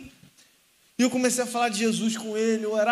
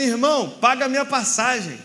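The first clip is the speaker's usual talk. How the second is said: loud demand; 3 semitones higher, 7 dB louder than usual. Acted. Homem exige a passagem no ônibus.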